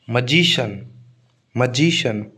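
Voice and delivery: Magician said muh-JEE-shun, the जी held; stress on जी. Shown as a demonstration. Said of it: "जी पर stress आएगा". (Magician के जी पर ज़ोर)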